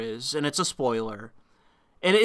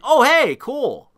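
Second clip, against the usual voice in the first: high, pleased little exclamation; light up on recognising him; pleased surprise; 8 semitones higher, slower than usual. Retold or imitated. Spotting the Chernobyl actor, high pleased surprise. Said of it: "I'm like".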